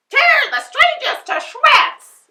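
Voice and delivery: very high, loud witch voice. A narrator's reading. Witch orders her wolves, high and loud. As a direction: shriek the order. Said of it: "she said".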